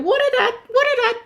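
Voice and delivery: high, quick repeated bird phrase; cardinal call. A narrator's reading. Cardinal in the noisy recap, high bird call. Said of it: "The cardinal said".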